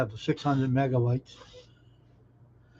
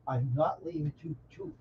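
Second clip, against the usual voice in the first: soft reassurance; 9 dB quieter than usual. Real, not acted. Signing off briefly to viewers.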